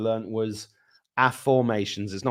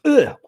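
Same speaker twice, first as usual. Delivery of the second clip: exasperated ugh; 9 dB louder than usual. Real, not acted. Reacts to Oprah confusion.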